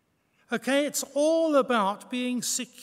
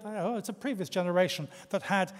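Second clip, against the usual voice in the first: usual speaking voice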